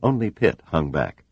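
plain reading voice

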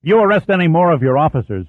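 snapped. Acted. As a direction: snap it back at the captain. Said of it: "Church snapped".

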